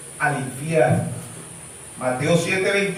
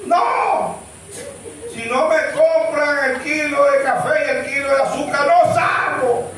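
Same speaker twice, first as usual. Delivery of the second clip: put-on stubborn old lady yelling; 7 semitones higher than usual. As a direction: shout back like a sulking old mother on strike. Sulking elderly mother refuses, strike.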